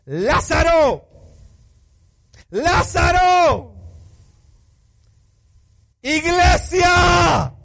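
high, slow shout, each name drawn out; loud cry. Acted. Jesus calls Lazarus and the church out of the grave, slow and shouting. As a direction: call him out of the tomb at full voice. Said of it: "clamó a gran voz".

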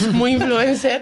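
laughing at herself; self-mocking laugh through words. Real, not acted. Joking about filming herself, laughing. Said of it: "laughs at herself".

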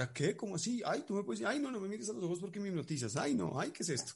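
high, gushing girlish imitation; playfully imitating a group of women; mock excited girls.

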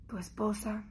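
mock-ominous whisper, a bit slow; whispered dread. Voicing her fear of the voice message, in a whisper. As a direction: breathe it like a scary diagnosis.

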